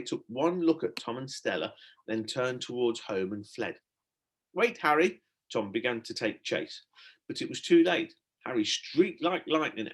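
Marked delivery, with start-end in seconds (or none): 4.5-5.2 calling out. A narrator's reading. Tom calls after fleeing dog.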